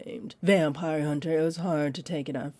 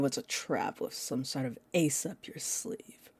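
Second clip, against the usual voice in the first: whisper; 3 semitones lower, 8 dB quieter than usual. Acted. Vampire teasing prey.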